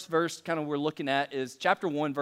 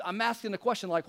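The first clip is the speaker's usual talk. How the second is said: ordinary talk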